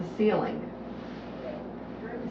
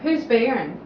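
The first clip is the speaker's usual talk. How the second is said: normal talking voice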